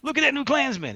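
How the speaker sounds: very high-pitched mock heckle; Love Kills impression. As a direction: imitate an inmate calling out to tease a newcomer. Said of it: "I speak like he speaks".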